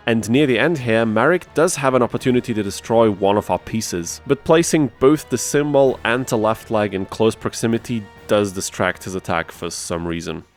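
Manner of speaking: ordinary talk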